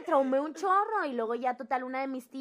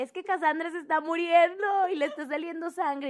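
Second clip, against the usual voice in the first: a touch higher, alarmed schoolgirl; mild voiced alarmed friend; 6 semitones higher, 4 dB louder than usual. Friend running to the nurse, lightly voiced alarm. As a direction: blurt it out like a scared little friend.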